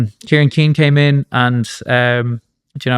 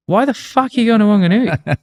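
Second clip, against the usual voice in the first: mock disbelief; 10 semitones higher than usual. Baffled passport officer quizzing him.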